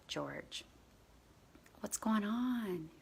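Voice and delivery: hushed, slowing to a near whisper; gentle concern. Retold or imitated. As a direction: softly ask a crying child. Checking on the crying child.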